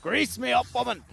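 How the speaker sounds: hammed-up Scottish bellow; Scottish accent. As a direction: shout it like a grumpy Scottish groundskeeper. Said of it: "Not the greatest Scottish accent".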